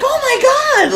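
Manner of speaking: very high, loud mock shriek; imitated shocked mom. Son retelling mom learning about the toy, mock shocked shriek.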